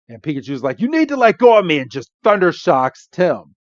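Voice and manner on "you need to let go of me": urgent shout. Retold or imitated. Pikachu shouting before shock.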